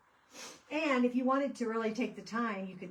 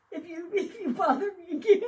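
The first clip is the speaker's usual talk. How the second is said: high and loud through real laughter; quoting a threat while cracking up; laughing; 11 semitones higher, 10 dB louder than usual. Real, not acted. Quoting a witchy post, laughing hard. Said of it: "I'm about dying laughing".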